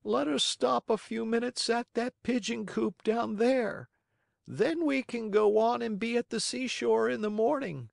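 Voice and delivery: high-pitched bird voice; high character voice for a pigeon; pigeon proposing a stop. Acted. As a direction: suggest it in a high, steady voice. Pigeon speaks to puppet.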